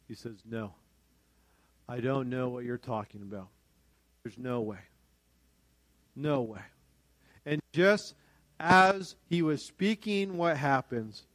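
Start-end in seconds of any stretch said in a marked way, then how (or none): 0.5-6.6 low, soft, firm denial; quietly adamant. Acted. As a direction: deny it firmly under the voice.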